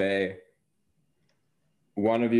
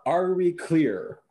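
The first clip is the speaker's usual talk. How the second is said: higher-pitched, commanding game character; in-character orders, pitched up; authority figure in character; 6 semitones higher, much slower than usual. Acted. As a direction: lay down the duty, then demand confirmation. Speaks in character as stern authority.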